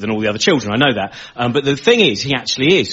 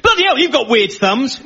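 mocking schoolboy; 11 semitones higher than usual. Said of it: "another boy came up to me and went".

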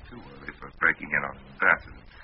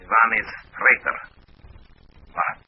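put-on raised, insistent Russian accent; put-on Russian accent, pitched up; best Russian accent; 5 semitones higher, 4 dB louder than usual. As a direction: play the stern Russian agent, accent on. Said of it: "in my best Russian accent".

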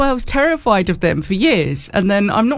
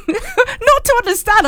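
laughing; 13 semitones higher, slower than usual. Real, not acted. Laughing protest about Geordie.

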